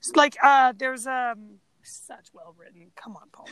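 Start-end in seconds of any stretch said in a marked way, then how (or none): 1.8-3.5 whispered to herself, voice dropped right down; embarrassed whisper. Real, not acted. A co-host whispering at herself after misspeaking, unvoiced whisper. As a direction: hush it, annoyed at her own slip. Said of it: "Just like you".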